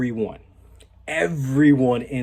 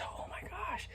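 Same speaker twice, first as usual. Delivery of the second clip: hushed, breathy amazement; barely voiced astonishment; awestruck whisper; 17 dB quieter, slower than usual. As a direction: whisper it to yourself, overwhelmed. Reacts to her sexy look.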